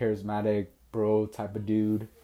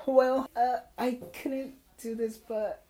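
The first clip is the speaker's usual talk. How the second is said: high, whimpering mock-tearful voice; halting imitation of a crying girl; imitated crying; 13 semitones higher than usual. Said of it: "running to me crying again".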